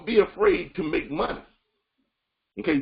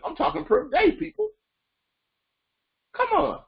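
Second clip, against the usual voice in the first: excited; 12 semitones higher than usual. Real, not acted. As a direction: pitch jumps way up on "per day, people, come on". Coach hyping results to listeners.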